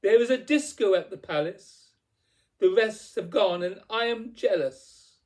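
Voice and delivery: spoilt, emphatic clamouring; demanding tantrum. A narrator's reading. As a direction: insist on every item, petulant and forceful. Cindy's shouted tantrum of demands continues.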